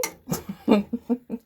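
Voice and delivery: a bright burst of real laughter; loud laugh. Real, not acted. Laughing at housemate's reply.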